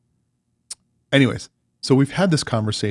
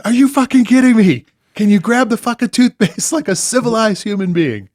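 high, loud outburst breaking into a laugh; retold rant at his wife over toothpaste; exasperated yell; 10 semitones higher, 5 dB louder than usual. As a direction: yell it again, laughing at yourself. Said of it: "I'm like".

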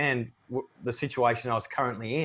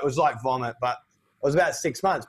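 ordinary talk, nothing marked

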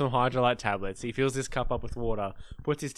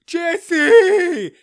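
put-on very high, loud drunken shout of a name; drunk happy yell; 12 dB louder than usual. Drunk birthday boy greeting his friend, loud happy yell. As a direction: greet like a hammered best friend.

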